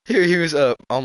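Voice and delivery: ordinary talk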